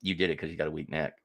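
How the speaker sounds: low, quiet, quick deadpan jab; quiet friend's put-down. Retold or imitated. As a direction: drop it quietly and fast. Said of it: "he just goes".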